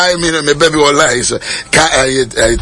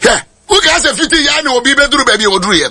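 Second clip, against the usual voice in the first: ordinary talk, nothing marked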